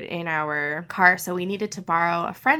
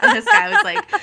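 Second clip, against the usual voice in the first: high, loud laugh breaking through; real laughter mid-sentence; 14 semitones higher, 9 dB louder than usual. Real, not acted. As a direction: laugh out the words. Laughing at the memory.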